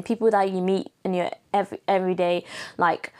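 normal talking voice

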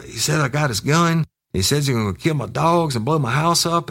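high-pitched, upset complaint; mock tearful caller. Crying kid telling Ken of the threats, mock tearful. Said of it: "He was cr-, crying".

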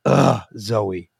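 low groan of distaste; disgusted. Real, not acted. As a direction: groan the name with weary dislike. Reads own disgusted note aloud.

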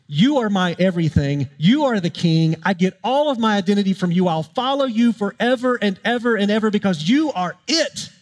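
preacher voicing a disciple's rising, fervent pledge; fervent proclamation. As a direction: proclaim it with mounting devotion. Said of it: "what Peter is proclaiming in this moment".

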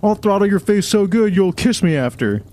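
high-pitched parody of a man threatening; mock angry threat. Mimicking the singer threatening a heckler, high mock anger. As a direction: keep the threat high and hammed-up.

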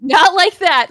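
high, loud flustered objection; loud protest. Real, not acted. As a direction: insist at a shout over the laughter. Repeats protest over laughter.

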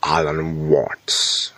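slow, drawn-out accented Alan Watts; slow hint of a British accent on Alan Watts; put-on accent. Shown as a demonstration. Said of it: "I wanna say it in the accent".

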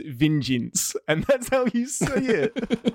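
"vengeance" drawn out with odd vowels; mimicked pronunciation. Reading chat's spelling to prove a point, exaggerated "vengeance". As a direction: spell out the mock "vengeance" slowly. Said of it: "has spelt it out correctly".

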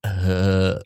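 low mumbly [name] as Mike Judge. Acted. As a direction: drawl it like a cartoon [name]. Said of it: "that's Mike Judge".

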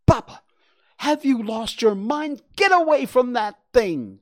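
high, loud frantic warning; alarmed warning. Acted. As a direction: yell at him to get away. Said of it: "Gwen warned".

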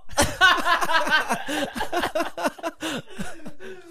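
squealing burst of laughter; high-pitched laugh. Real, not acted. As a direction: cackle up high. Laughing at the story.

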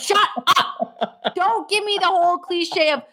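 high, laughing, fed-up rant; exasperated rant. Real, not acted. Fan ranting at player cliches, high exasperated laugh. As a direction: vent your frustration while half laughing.

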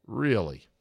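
ordinary delivery

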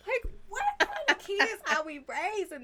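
high, loud, incredulous outcry; exasperated disbelief. Real, not acted. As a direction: cry it out in parental despair. Exasperated parent exclaims about kids.

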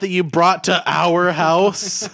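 loud, high, fed-up yell; exasperated shout. Acted. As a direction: snap at a nagging grandpa. Frodo snapping at Bilbo.

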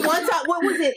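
normal talking voice

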